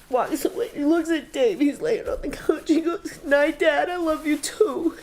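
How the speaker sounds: high, tearful voice close to crying; choked up at a tender memory; real tearful grief. Real, not acted. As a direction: tell it through tears. Mother recalls son's last goodnight.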